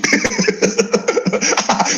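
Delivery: high-pitched giggling laugh; high giggle. Real, not acted. Laughing at his own joke about the lie, high giggle. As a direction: break into a squeaky giggle. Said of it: "I'm gonna just giggle and sniggle".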